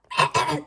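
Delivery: a little fake cough; small cough. The flea coughs in the story.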